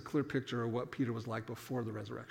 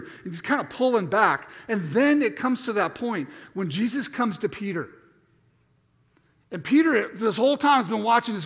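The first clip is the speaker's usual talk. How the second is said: ordinary talk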